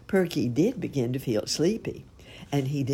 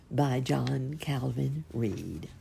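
usual speaking voice

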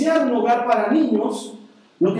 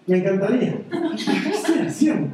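laughing disbelief; 3 semitones higher than usual. Retold or imitated. Retold reply to Dana.

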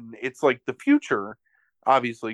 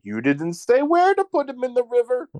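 much higher, put-on voice with a rising end; whiny Zeus; 11 semitones higher than usual. Zeus excusing himself to Hera in a high put-on voice. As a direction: play it as a sheepish god making an excuse.

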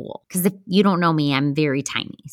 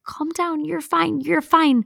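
high-pitched imitation of calling out; mock yell, pitched high but held back in volume; retold yelling; 11 semitones higher than usual. Calm friend talking her off the ledge, retold yelling. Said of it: "so she's yelling".

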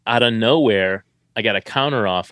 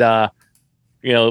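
ordinary talk

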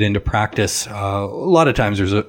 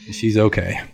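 ordinary talk

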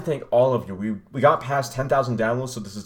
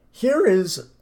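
voice crack; 10 semitones higher, 4 dB louder than usual. Real, not acted. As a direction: voice breaking upward on here. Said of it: "wow, voice crack".

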